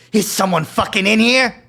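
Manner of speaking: high, loud, slow weasel snarl; grating nasal voice. Acted. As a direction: bark it like a furious weasel. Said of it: "Duke's grating, nasally voice".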